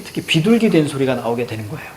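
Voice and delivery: normal talking voice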